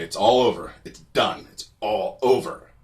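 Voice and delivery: loud, emphatic call to stop; retold firm command. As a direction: break up the fight with forceful, punched words. Breaking up a fight.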